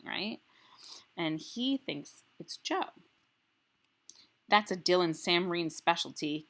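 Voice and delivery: reading a chapter aloud to the class; story narration. Acted. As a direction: tell the story in the boy's voice. Teacher reads the next chapter.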